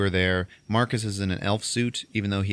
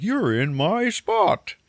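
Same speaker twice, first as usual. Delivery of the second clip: high, drawn-out Santa voice; hammed-up Santa, very slow and high; Santa in character; 12 semitones higher, 4 dB louder, much slower than usual. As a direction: stay in Santa character while complaining. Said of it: "staying in character while he's talking".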